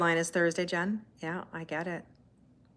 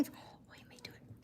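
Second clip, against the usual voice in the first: whisper; 24 dB quieter than usual. Real, not acted. Cat about to appear.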